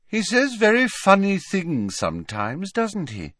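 put-on high-pitched, unhurried girl's voice; candid, vague Luna.

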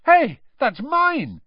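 high, loud, drawn-out exclamation; startled recognition. A narrator's reading. Boy recognising his wand, startled call. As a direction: blurt it out in surprise.

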